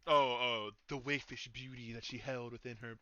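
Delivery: hammed-up swooning narrator voice; mock romance narrator. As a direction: gush it like a cheesy romance novel. Mocking flowery narration.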